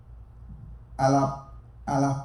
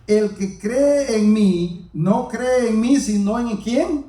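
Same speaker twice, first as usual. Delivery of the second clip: slow, drawn-out declaration, slightly raised; slow proclaiming; 3 semitones higher, much slower than usual. Retold or imitated. Preacher voicing Jesus crying out to the people, slow proclaiming. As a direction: proclaim Jesus' words slowly. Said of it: "Jesús clamó y dijo".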